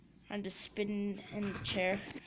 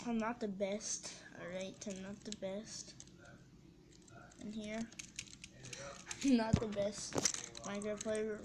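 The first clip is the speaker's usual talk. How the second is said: normal talking voice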